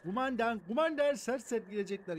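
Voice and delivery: warning the commander they will storm in; mock commander. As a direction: play a barking army grunt. Gamer voicing a soldier.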